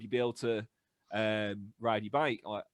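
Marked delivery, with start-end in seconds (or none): none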